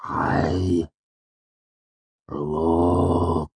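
stretched, thick vowels; dazed, each word dragged out; very slow. A narrator's reading. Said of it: "he said slowly".

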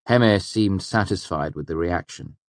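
usual speaking voice

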